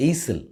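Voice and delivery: EISEL said as "AY-sul", S sounded; mispronounced with S. Shown as a demonstration. Teacher shows another common mistake, S sounded. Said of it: "or even as".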